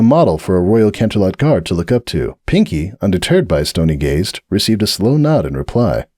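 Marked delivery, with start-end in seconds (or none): none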